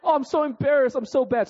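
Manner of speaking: high, loud self-scolding whine; mock despair. Golfer berating himself after a bad shot, in mock despair. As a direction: ham up the embarrassment, pitched high.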